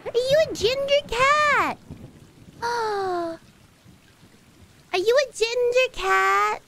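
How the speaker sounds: high, thrilled pet talk; high-pitched adoring question; excited cooing. Real, not acted. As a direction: squeal the question at a kitty.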